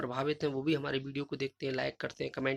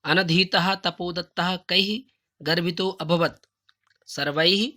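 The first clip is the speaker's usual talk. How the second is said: slow loud recitation; 6 dB louder, much slower than usual. Shown as a demonstration. Teacher recites the answer slowly and loudly to model pronunciation. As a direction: read the Sanskrit answer slowly and loudly, every word separate. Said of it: "उच्चारण के लिए हम आपको ये उच्चारण कर रहे हैं".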